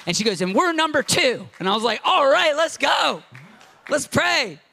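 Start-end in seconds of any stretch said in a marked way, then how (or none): 0.4-1.5 high and excited; a wife bursting with faith. Retold or imitated. Wife sharing her hope, high and excited. 2.0-4.6 high, loud call to pray; rally them like a coach; fired-up shout. Retold or imitated. Preacher rallying the couple to pray, high and shouting.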